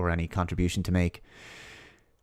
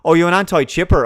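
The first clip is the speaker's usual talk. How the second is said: higher, louder sneering put-down; mocking scornful accusation, pitched up; sneering mockery; 9 semitones higher, 7 dB louder than usual. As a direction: sneer at the outsider. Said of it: "And then it'll be".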